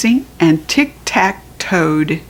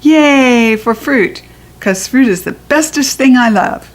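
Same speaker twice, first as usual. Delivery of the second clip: put-on excited little girl; 4 semitones higher than usual. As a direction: cheer like a delighted kid. Junie B cheering, slow, far.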